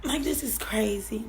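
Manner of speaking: a broken whisper. Real, not acted. A stunned mother whispering in disbelief. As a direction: barely breathe the words.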